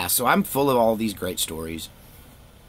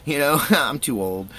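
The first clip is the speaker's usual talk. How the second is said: chuckling through the words, higher; laughing; 6 semitones higher, 4 dB louder than usual. Real, not acted. Laughing at himself for being too old to worry about DNA tracking. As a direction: laugh it off as you talk. Laughing at his own age joke.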